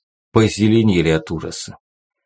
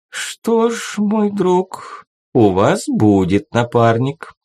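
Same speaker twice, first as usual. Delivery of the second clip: gentle promise; 6 semitones higher, slower than usual. A narrator's reading. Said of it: "ласково пообещал монарх".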